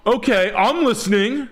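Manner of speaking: high, mocking eager voice. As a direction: play a greedy person suddenly interested. Mocks greedy people eyeing money.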